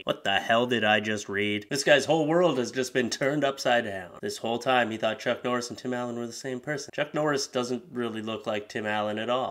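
ordinary talk, nothing marked

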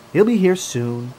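plain reading voice

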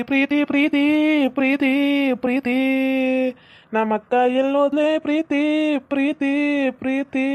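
high, drawn-out calls of Preethi; calling her name, rising then fading. Acted. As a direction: call across the yard for the girl. Uncle calls out for niece.

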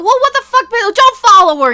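loud, high-pitched angry burst; exasperated outburst. Real, not acted. YouTuber tells critics to stop following her, in real exasperation. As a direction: snap back loudly at the online mob. Ranting at people attacking a celebrity.

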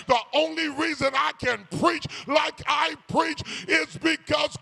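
rising, shouted preacher's build; preaching. Acted. Preacher driving the point home to the congregation, shouting. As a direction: preach it with mounting fire.

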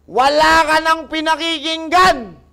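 loud, high-pitched real telling-off; scolding shout. Real, not acted. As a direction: yell a sermon at a stubborn patient. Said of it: "Eh, ba't naninigaw ka".

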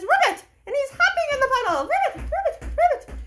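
very high, bright croaky ribbits; happy frog ribbit. Acted. Child hopping in the puddle, squeaky happy frog voice. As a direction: bounce it out like a gleeful little frog. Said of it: "And he says".

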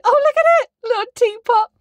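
high, loud gush over something cute; cutesy squeal. Real, not acted. Showing viewers the teapot design, squealing with delight. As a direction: coo like it's adorable.

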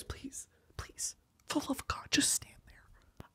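breathless pleading whisper, slowed at the end; desperate whisper. Acted. Whispering a plea to the cosplayer on screen. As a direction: beg under your breath for him to stop. Begging the video guy to stop.

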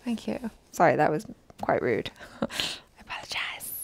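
barely there, fading to a whisper; very quiet apology. Real, not acted. Sheepish near-whispered sorry to friend. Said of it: "how much quieter you can possibly get".

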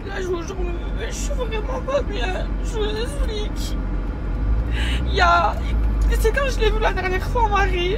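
tearful, voice high and breaking; crying. Real, not acted. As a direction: speak through real tears, pitch up. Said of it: "vous savez pourquoi je pleure".

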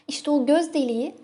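about to giggle at herself; embarrassed amusement. Acted. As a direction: let a self-conscious laugh creep in. Embarrassed laugh breaks into confession.

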